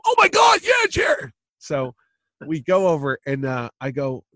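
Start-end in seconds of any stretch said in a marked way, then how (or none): 0.1-1.3 very high, loud excited shout; mock-excited high-pitched holler; retold yell. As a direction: yell it out, voice shooting way up. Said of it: "we yell about it and go".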